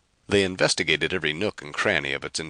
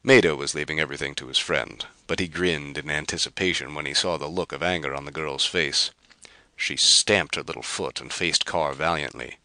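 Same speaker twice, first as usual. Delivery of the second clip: ordinary delivery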